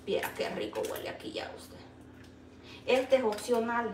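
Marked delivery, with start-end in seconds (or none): 0.1-1.8 soft whispered aside. Real, not acted. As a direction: whisper it close to the mic. Aside to viewers while cooking.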